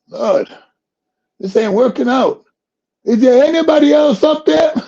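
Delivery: high, loud exasperated call; desperate shout. Retold or imitated. Jim on the cliff giving up on God, high loud exasperated shout. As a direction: shout up for anyone else.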